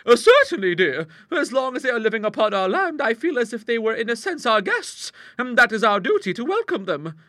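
high, loud proper spinster; gentle old lady voice. Acted. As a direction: soothe her sister like a kindly old lady. Elderly sister answers in character.

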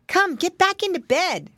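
piglet brother calling out, high; high child voice, bossing a sibling; Curly Tail. A narrator's reading. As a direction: call it out as a little boy pig. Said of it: "called Curly Tail".

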